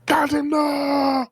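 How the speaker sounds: high drawn-out in-character scream; Waylon screaming. Acted. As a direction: scream it high, stretching the words. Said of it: "that's what you hear Waylon scream".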